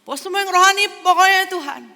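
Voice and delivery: high, loud, gushing devotion; mock zealous believer. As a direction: parody the Sunday-only believer.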